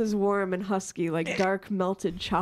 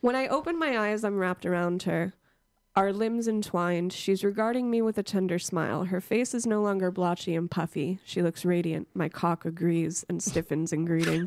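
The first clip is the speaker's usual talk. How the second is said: soothing reading voice; 2 semitones lower than usual. Acted. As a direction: read it like a bedtime story. Reading book aloud, soothing.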